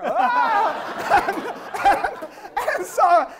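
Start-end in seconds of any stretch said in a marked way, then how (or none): 0.1-1.3 high laughing mock scream while fleeing; startled squeal, played for laughs. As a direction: yelp as if running away, laughing. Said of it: "I run from her".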